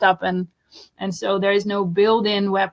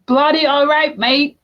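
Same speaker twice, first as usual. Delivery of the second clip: put-on higher, louder Australian accent; mock Aussie slang; 7 semitones higher, 4 dB louder than usual. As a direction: shout it like a cheery Aussie mate. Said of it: "Those Aussies are pretty colorful people".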